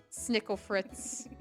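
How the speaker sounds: ordinary talk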